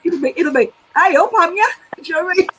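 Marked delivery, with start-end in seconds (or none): 0.9-1.7 put-on Wolverhampton accent. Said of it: "correct them to speak like Wolverhampton".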